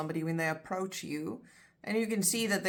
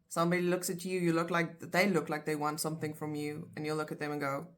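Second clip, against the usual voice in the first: ordinary delivery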